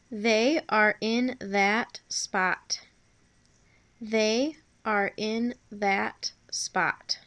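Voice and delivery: very slow, word by word; measured teacher's dictation with gaps between words. Shown as a demonstration. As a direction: dictate for young writers, separating each word. Teacher dictating sentence slowly.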